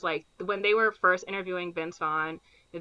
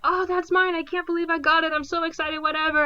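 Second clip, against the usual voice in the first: high, hammed-up fake excitement; mock excited winner; 9 semitones higher, 3 dB louder than usual. Ben Stiller pretends to win, hammed-up high glee. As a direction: squeal like a fake lottery winner.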